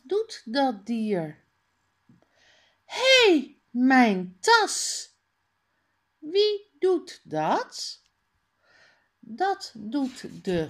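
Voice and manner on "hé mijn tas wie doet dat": indignant cry. Acted. Character in picture book cries out.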